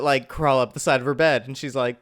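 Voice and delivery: normal talking voice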